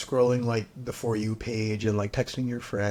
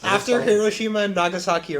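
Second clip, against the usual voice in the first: louder and higher, words bursting through laughter; laughing while talking; 8 semitones higher, 8 dB louder than usual. Real, not acted. Defending a shocking joke about Japan to friends, laughing loudly. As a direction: push the point through your own laughing.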